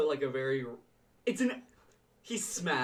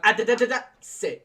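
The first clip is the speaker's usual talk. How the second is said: loud high staccato, then a firm command; scolding interruption; 5 semitones higher, 8 dB louder than usual. Acted. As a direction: cut them off like ordering a dog. Characters order Sparky to stay.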